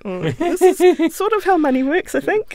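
high, loud mock-pondering voice; imitating a clueless expert's hammed-up musing; mock know-it-all musing. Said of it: "'cause I'm like".

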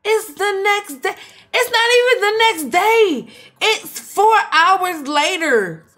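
high, loud, stretched-out disbelief with a laugh; scandalized. Real, not acted. Streamer gossips in shock about Ebony cheating, high and scandalized. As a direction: act scandalized, dragging the words. Sim cheated day before vows.

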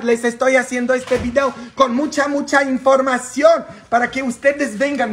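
high, loud, fired-up appeal; excited. Real, not acted. Priest urging viewers to share the video, excited. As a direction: call out to the crowd, high and excited. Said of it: "estoy emocionado".

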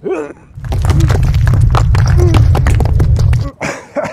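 loud high-pitched strained laugh. Real, not acted. Straining to lift stone, laughing.

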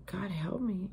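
soft unvoiced plea, falling at the end; quiet whispered cry for help; whispered prayer. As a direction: murmur it as a humble prayer.

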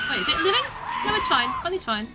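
usual speaking voice

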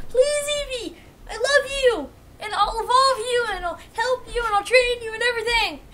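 high, loud tearful pleading; desperate begging. Real, not acted. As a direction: beg the Pokémon with everything you have. Begging Eevee to stay caught.

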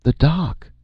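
high-pitched alarmed cry; screamed alarm. A narrator's reading. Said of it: "one of the clerk's typists screamed".

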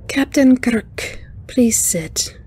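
high, airy alien voice; light, chiming, unhurried greeting; wind chime voice. A narrator's reading. Alien guest greeting the captain, wind chime voice. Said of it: "the wind chime voice said".

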